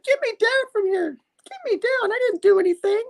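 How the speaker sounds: very high falsetto mock plea; pleading woman. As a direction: squeal for help in falsetto. Woman hanging upside down pleads.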